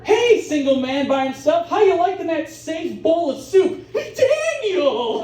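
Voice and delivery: high, perky mock server call; teasing waitress voice. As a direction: call out gushingly, rising to a squeal. Imagined waitress calling out to Daniel.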